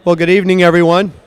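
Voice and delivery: loud, projected greeting to the hall; loud opening "Well, good evening, everyone"; booming welcome. Real, not acted. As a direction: call the greeting out over the room. Opening welcome, mic too loud.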